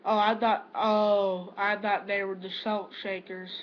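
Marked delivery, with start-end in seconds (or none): none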